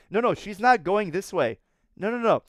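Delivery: ordinary delivery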